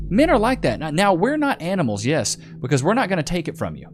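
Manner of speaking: ordinary delivery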